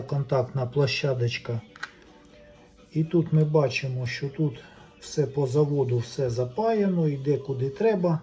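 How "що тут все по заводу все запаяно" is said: ordinary talk, nothing marked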